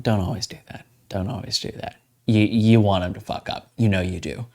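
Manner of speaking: ordinary talk, nothing marked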